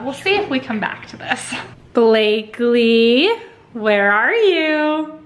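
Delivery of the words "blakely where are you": drawn-out, loud singsong call; playful calling out. Pretend. Mom calling Blakely in hide-and-seek, playful singsong. Calling for hiding toddler in play.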